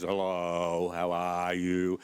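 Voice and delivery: slow, low Australian greeting with flat vowels; a drawn-out Australian hello. Shown as a demonstration. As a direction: lean into the broad Australian accent. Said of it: "We have very flat vowels".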